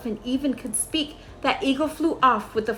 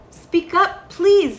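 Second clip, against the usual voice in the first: louder, higher call to a faint voice; 7 semitones higher, 6 dB louder, much slower than usual. A narrator's reading. Horton calls down to the tiny speck, raised higher call.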